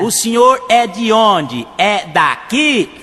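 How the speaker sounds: very slow, high, drawn-out question; slow on purpose. Retold or imitated. Farmer asking the driver very slowly. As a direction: stretch every word for the foreigner. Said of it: "você fala devagar".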